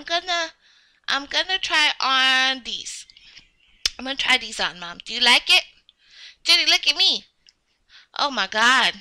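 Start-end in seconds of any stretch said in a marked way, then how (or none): none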